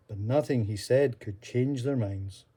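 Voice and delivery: ordinary delivery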